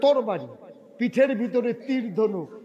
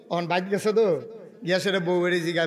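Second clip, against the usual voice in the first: ordinary talk, nothing marked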